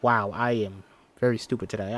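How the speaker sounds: usual speaking voice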